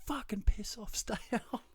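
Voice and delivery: strained, high mock fury; mock angry yell. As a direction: re-enact chasing the cat off, squawking. Retold yelling cat down hallway.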